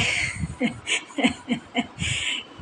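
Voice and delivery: a run of quiet giggles. Real, not acted. Vlogger amused at her own habit, quiet giggle.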